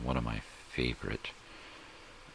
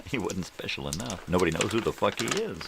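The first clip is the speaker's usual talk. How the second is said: mocking laugh; 7 semitones higher, 4 dB louder than usual. Real, not acted.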